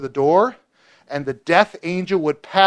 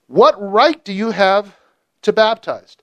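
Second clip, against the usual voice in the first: slow, higher, pointed challenge; challenging demand; 5 semitones higher, 5 dB louder, slower than usual. Retold or imitated. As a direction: drag out the accusing question. Pharisees challenge John.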